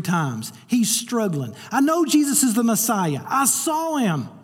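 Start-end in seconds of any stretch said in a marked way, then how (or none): 1.7-4.3 voicing Peter. Preacher voicing struggling Peter.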